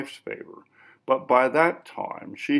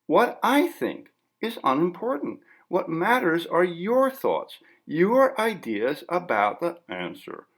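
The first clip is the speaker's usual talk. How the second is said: higher, prim deflecting voice; higher, smooth journalist's voice dodging the question; prim interviewer; 7 semitones higher than usual. Acted. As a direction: lift the pitch and stay coolly polite.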